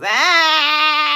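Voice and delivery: long, high, loud mock bawl; imitated crying wail. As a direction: hold one loud comic howl. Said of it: "where the guy goes".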